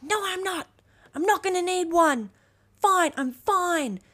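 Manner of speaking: put-on high, whiny stubborn child denying the cold; mock bratty child, high and loud; defiant kid. Stubborn kid refusing jacket.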